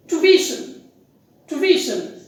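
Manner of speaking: tuition said "too-VI-shun", three syllables; correct tuition. Shown as a demonstration. Teacher modelling the correct word, tuition stressed on second syllable. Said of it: "The stress is on the second syllable".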